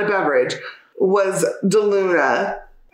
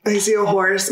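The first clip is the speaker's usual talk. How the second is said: normal talking voice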